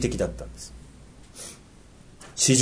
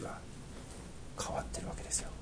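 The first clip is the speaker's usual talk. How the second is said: hushed whisper; 23 dB quieter, faster than usual. Real, not acted. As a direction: drop to a breathy whisper for effect. Preacher drops voice for effect. (ささやき声で)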